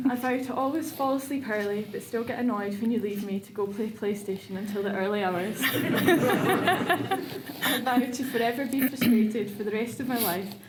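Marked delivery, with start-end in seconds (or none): 5.6-9.5 laughing. Real, not acted. Teasing vow, breaks into laughter.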